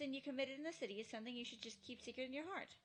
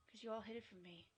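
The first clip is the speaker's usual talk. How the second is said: normal talking voice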